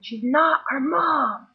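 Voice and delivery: put-on loud, slow, whiny child's protest; child's blunt shout. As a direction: shout it like an indignant little girl. Child loudly corrects strangers.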